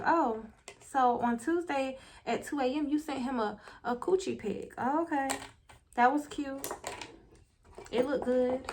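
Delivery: soft, mock-casual reading aloud; quietly needling, imitating a petty man. Man reading her messages back to her, softly petty. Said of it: "he was being so petty like that".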